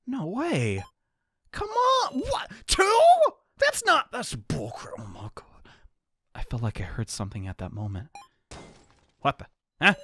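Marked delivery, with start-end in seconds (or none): 1.5-5.0 high, shouted disbelief; outraged. Acted. Outraged at two deaths. 5.0-5.7 stunned whisper. Acted. Whispered shock.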